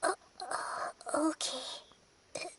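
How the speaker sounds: shy whisper. Acted. Shy thanks after being helped.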